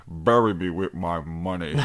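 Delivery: low, put-on dying villain voice; dying boss. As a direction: groan it low as a defeated game boss. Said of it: "He just goes".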